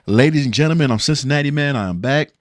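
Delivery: loud announcer voice; show host intro. Acted. Vlogger opening his next episode review like an announcer. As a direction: announce yourself to the audience, big and loud.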